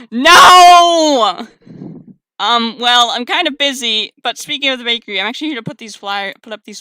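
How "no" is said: long, loud, high no; drawn-out dramatic cry; anguished wail. Acted. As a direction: wail it in mock despair. Wails at Yeti in love.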